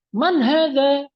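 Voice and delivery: "man hadza" as a question, high and loud; questioning intonation. Shown as a demonstration. Teacher showing students a question, questioning intonation on "man hadza". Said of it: "intonasi berbicaranya itu intonasi bertanya".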